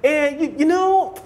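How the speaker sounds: mocking a fussy teacher. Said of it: "They give you that kind of nonsense".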